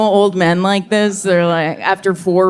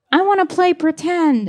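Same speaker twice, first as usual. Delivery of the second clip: put-on higher, eager kid voice; small child asking; 9 semitones higher than usual. Toddler to babysitter, eager high kid voice. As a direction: ask like a little boy wanting to play. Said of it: "he was like".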